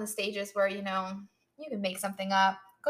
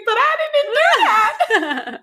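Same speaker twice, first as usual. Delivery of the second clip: very high, loud, drawn-out whine with a laugh; hammed-up wail of a wrongly accused man; mock indignant protest; 13 semitones higher, 12 dB louder, much slower than usual. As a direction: protest slowly in a squealing mock yell. Acts out man protesting being lectured.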